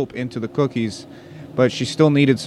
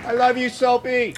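adoring fan; 13 semitones higher than usual. Pretend. Heckler fan shouts.

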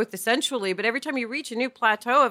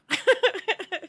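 laugh; 8 semitones higher, 3 dB quieter than usual. Real, not acted. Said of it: "laughs at own joke".

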